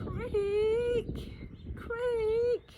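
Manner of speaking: high creaking groan. Acted. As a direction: creak like rusted metal. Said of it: "he groans".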